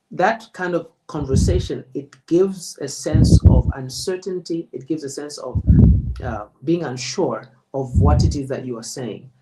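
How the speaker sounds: ordinary delivery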